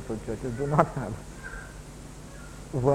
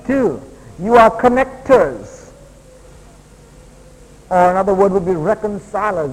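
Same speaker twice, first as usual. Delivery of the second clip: loud, raised preacher voice; high, forceful sermon delivery; emphatic preaching; 6 semitones higher, 11 dB louder than usual. Acted. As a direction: drive each point home like a preacher. Preaches numbered points.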